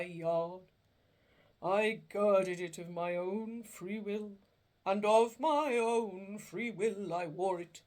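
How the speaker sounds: slow, loud, mournful spirit voice; a chained ghost lamenting its fate; doleful ghost. Acted. Marley's ghost lamenting to Scrooge, slow and doleful.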